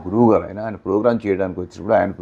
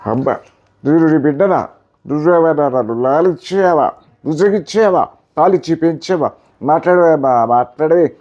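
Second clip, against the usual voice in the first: higher, pleading film-hero delivery; Akkineni Nageswara Rao style; 7 semitones higher, 4 dB louder than usual. Retold or imitated. Son begging mother to answer him, pleading hero voice. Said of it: "Nageswararao".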